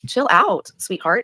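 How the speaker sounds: high and loud, brushing her off; loud high put-down; loud dismissive retort. Real, not acted. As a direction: tell her to calm down, loudly. Dismissive snap at critic.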